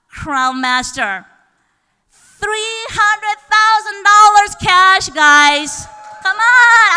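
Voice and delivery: slow, loud, high hype announcement; excited. Real, not acted. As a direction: build the hype, shout it out. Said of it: "I'm gonna get a little bit excited".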